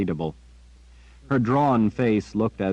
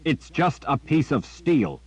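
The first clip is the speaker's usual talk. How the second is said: angry outburst, higher; erupting in anger; 6 semitones higher than usual. A narrator's reading. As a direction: snap it out hotly. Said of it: "Richard erupted".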